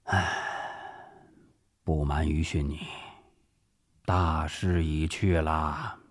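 sigh, then let the words drag out low; resigned sigh. Acted. Director confiding defeat to his officer, sighing resignedly. General sighs again, confessing defeat.

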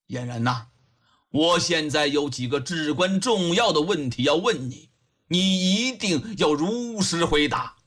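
put-on low, deliberate elderly official; old judge, serious. Old judge prepares to question the young woman, gravely. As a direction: press gravely for an honest answer.

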